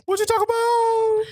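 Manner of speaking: very high squeal of a mock catchphrase; Arnold. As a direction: send the sitcom catchphrase way up high. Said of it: "Um, uh, Arnold".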